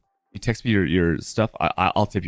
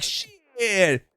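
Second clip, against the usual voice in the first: amazed mover; 8 semitones higher, 3 dB quieter than usual. Retold or imitated. Mahmoud's amazed reaction retold.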